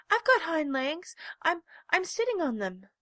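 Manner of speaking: high, loud stammering insistence; insist, tripping over words; flustered insistence. A narrator's reading. Little rabbit insists he has legs, high and loud. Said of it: "cried the rabbit".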